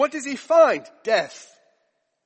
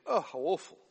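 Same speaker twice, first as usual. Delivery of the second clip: mock dismay; 4 semitones lower, 8 dB quieter than usual. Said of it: "Now, of course, we think".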